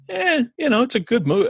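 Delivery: high, dismissive "eh"; unimpressed shrug. Retold or imitated. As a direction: shrug it off, half-hearted. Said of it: "I was just kinda like".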